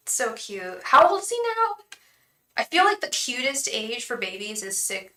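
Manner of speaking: ordinary talk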